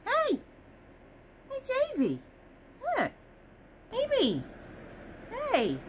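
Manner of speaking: high sing-song voice to the cat; high pet-talk voice. Real, not acted. Talking to the cat Evie, high cooing voice.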